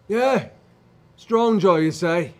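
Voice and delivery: loud, high taunting shout; challenging rival. Acted. Bearded tribesman doubts the apparition, shouting a challenge. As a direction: call it out loudly as a challenge.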